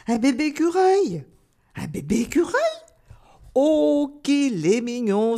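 put-on little rabbits cooing over a cute baby; lightly acted delighted cries; excited exclamations. A narrator's reading. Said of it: "s'écrièrent tous les petits lapins". (exclamations attendries de petits lapins)

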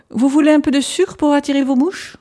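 doctor calls loudly to a man plugging his ears, loud and clear; speaking up loudly. A narrator's reading. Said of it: "en parlant assez haut pour qu'il m'entendît".

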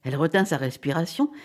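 ordinary delivery, nothing marked

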